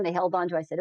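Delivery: usual speaking voice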